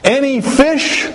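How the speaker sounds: high, drawn-out call across water; high-pitched distant hail. Retold or imitated. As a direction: sing it out high to people far off. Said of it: "he called out, he said".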